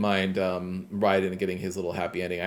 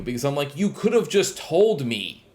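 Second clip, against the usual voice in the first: annoyed rant; 6 semitones higher than usual. Real, not acted. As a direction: vent the irritation, voice pushed up high. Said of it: "the only thing that pissed me off".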